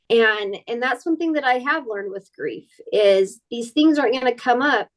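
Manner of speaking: normal talking voice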